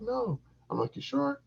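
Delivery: high squeaky quoted exchange; mock high voices. Retelling a bedroom exchange, high mock voice. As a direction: pitch it way up. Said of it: "She's like".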